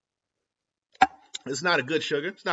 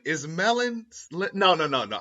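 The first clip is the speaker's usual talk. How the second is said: ordinary talk, nothing marked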